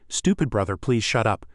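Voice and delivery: ordinary delivery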